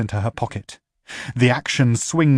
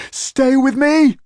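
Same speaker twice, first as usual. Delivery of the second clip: much higher, loud pleading cry; desperate shout; 7 dB louder than usual. Acted. Duet screaming at her partner to stay with her.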